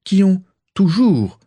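«toujours» appuyé, bien plus aigu et lent; insistance. Acted. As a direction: insister lourdement. Rosier insiste, voix changée. (Rosier insiste sur «toujours», aigu et lent)

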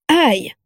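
high, short yelp of pain; startled ouch. A narrator's reading. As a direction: yelp as if jolted from thought. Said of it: "sursauta Orion". (petit cri de douleur surpris)

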